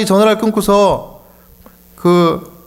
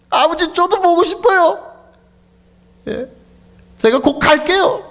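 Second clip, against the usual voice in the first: crying, much higher pitched; tearful plea; 13 semitones higher than usual. Retold or imitated. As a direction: promise your father through tears. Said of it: "눈물이 쭉쭉 쏟아지는".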